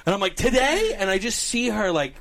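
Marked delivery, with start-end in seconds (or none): 0.4-0.9 high, loud disbelieving question; incredulous. Retold or imitated. Husband hears the date on the phone, incredulous. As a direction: imitate being thrown by sudden news. Shocked reply to friend on phone.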